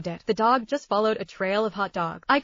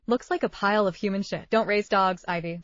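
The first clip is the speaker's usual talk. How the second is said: plain reading voice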